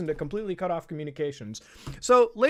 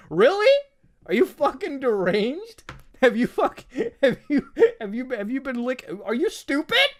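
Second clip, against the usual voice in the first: higher and louder, in total disbelief; incredulous outburst; 7 semitones higher, 6 dB louder than usual. Real, not acted. Ranting at tweet authors, incredulous raised-voice disbelief. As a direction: yell it like you can't believe anyone wrote this.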